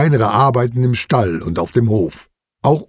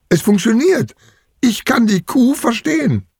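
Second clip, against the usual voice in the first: put-on high-pitched child's wonder; amazed; 10 semitones higher than usual. A narrator's reading. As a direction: marvel in a high, thrilled voice. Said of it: "staunt Wimmelbiene".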